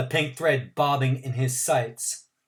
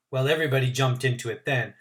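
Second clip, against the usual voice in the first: usual speaking voice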